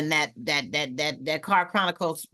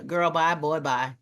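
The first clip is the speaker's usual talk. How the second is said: ordinary talk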